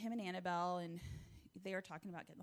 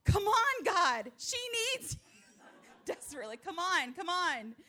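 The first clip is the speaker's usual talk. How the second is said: urgent pleading; 9 semitones higher, 12 dB louder than usual. Retold or imitated. Boy pleading loudly in prayer.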